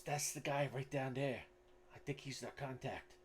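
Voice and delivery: lightly voiced character pointing someone out; Gustav. Gustav points out the contact to the party, lightly put-on voice.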